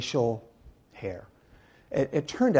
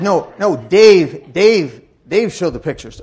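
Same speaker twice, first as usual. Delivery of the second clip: Dave called out louder; loud insistent correction; 5 semitones higher, 14 dB louder, slower than usual. Retold or imitated. Husband correcting wife before the crowd, loud insistent.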